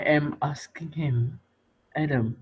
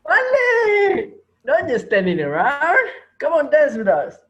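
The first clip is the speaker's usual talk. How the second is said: menacing cheer; 8 semitones higher, 4 dB louder than usual. Acted. Teacher coaxes Malik.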